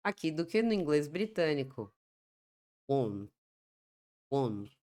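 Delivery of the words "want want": British "Want" with a rounded o; British English Want, closed vowel; British pronunciation. Shown as a demonstration. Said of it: "uma pronúncia mais aberta das vogais".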